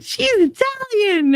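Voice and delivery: very high, slow, thrilled; excited child. Retold or imitated. Remembering her childhood thrill at the movie, very high and slow. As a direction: announce it like a proud little kid. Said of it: "I was like".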